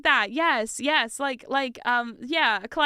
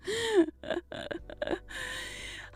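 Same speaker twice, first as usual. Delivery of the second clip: breathy high laugh; 11 semitones higher, 6 dB quieter than usual. Real, not acted. Laugh after bit.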